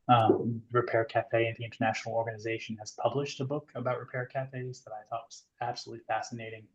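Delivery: ordinary talk, nothing marked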